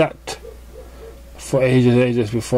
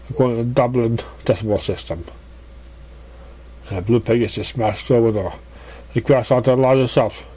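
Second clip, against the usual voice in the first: usual speaking voice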